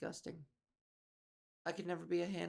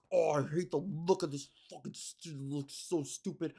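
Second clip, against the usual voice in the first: lower, dismissive sneer at a trailer; mock snobby critic; 4 semitones lower than usual. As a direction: sneer like a bored online critic, slowing at the end. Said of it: "everyone's like".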